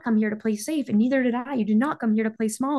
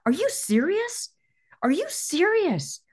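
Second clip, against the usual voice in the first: incredulous; 10 semitones higher than usual. Real, not acted. Incredulous challenge to listeners.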